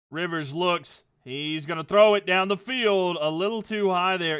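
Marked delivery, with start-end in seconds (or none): 1.2-4.4 louder play-by-play as the ball flies; excited play call. Acted.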